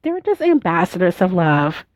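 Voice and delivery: usual speaking voice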